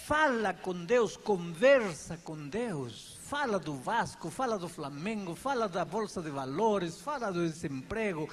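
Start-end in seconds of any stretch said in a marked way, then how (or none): 0.1-3.2 stretched out, each word weighed; slow emphatic urging. Real, not acted. Pastor urging the congregation to talk with God, slow and emphatic. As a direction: lean on every word, slowly. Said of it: "preacher urging".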